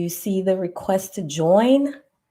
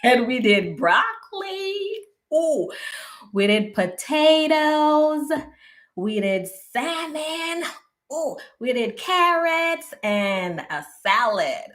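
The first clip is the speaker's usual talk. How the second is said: higher, pleased ooh over each dish; delighted listing; 6 semitones higher than usual. Real, not acted. Telling viewers about a healthy dinner, delighted. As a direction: savour each dish proudly.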